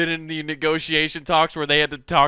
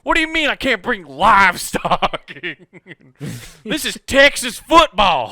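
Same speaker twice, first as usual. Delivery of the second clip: loud, high indignant Texan; mock Texas fan; 4 semitones higher, 6 dB louder than usual. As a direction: protest like a proud Texas fan.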